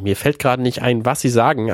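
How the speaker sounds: usual speaking voice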